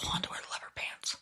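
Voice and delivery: hushed whisper, like sharing a secret; whispered confession. Real, not acted. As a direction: whisper it as a guilty little admission.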